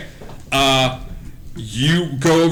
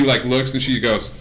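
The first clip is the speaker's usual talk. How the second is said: normal talking voice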